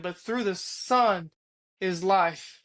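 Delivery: slow, hushed divine voice, lightly voiced; slowed-down, gently otherworldly voice of the Lord; slow voice of God. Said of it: "and he said".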